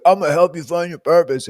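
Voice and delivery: mock life coach promise; confident guru. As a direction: sell it like a purpose coach. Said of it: "supposed to be the dude who's like".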